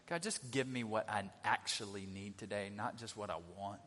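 soft, low, humble request; humble prayer. Shown as a demonstration. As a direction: pray humbly and quietly.

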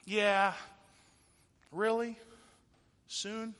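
high, halting doubter; unconvinced, with long hesitant gaps; skeptical. Acted. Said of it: "And you're just kinda like".

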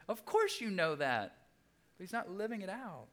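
usual speaking voice

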